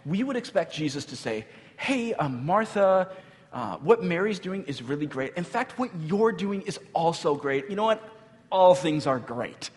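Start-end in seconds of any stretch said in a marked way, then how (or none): 1.8-9.7 high, placating mock reassurance; people-pleasing, lilting mock praise; overly diplomatic. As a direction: gush mildly to smooth things over. Voicing a diplomatic Jesus we'd expect.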